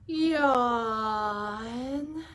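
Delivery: big acted yawn. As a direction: stretch out a sleepy yawn. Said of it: "He's yawning".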